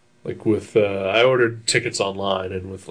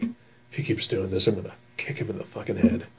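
hushed, breathy threat under the breath; whispered threat; 7 dB quieter than usual. Retold or imitated. Grumbling to Amanda in the dark theater, hushed whisper. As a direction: mutter it so only the person beside you hears. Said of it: "At that volume level".

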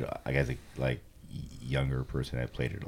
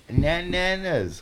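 put-on high, slow baby talk; toddler voice; 11 semitones higher, much slower than usual. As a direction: mispronounce it like a two-year-old. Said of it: "he goes".

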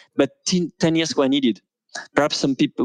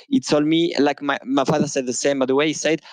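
normal talking voice

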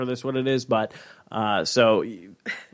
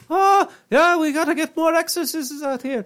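high-pitched comic Irish priest voice; hammed-up Irish accent. Joking as an Irish priest wanting exorcists, hammed-up Irish accent. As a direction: squeal it in a hammed-up Irish brogue. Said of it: "I have the Irish accent".

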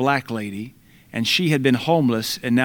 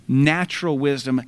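ordinary delivery

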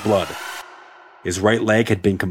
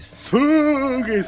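high, slow ghostly spooky voice; 14 semitones higher, slower than usual. Shown as a demonstration. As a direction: moan it out like a ghost. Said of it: "you gotta have that spooky voice".

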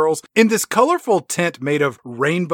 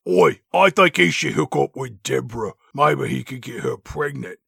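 put-on cockney girl gossip; mock cockney; 4 semitones lower than usual. Spice Girls gossip.

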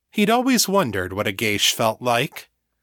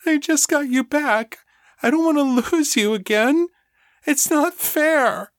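high, tearful, slowing on it's not fair; tearful plea; 3 dB louder than usual. Acted. Rebecca begs Daniel not to leave, high and tearful. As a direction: plead through tears, voice pitched up. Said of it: "eyes welling up with tears".

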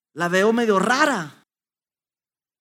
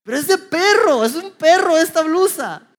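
higher, louder, flustered excuse; voicing his wife; 7 semitones higher, 5 dB louder than usual. Wife explaining the shirt to her husband, flustered and higher. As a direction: let the wife's voice rise as she defends herself.

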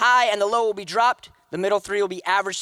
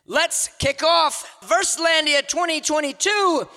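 hype announcer; 6 semitones higher, 3 dB louder than usual. Real, not acted. Host kicking off Verselandia and welcoming Danica Leong, hype announcer call. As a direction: launch the show with big energy.

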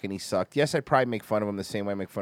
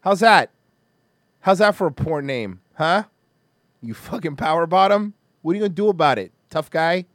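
loud, raised taunting questions; aggressive challenge; 5 semitones higher, 7 dB louder than usual. Real, not acted. Host daring his rival to respond, loud confrontational taunting. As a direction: get in his face, loud and high.

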